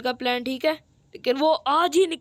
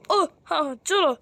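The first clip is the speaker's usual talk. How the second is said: ordinary talk, nothing marked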